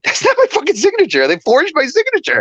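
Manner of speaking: retold indignant yell; indignant outburst. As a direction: protest the forged signature hotly. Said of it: "I'm like".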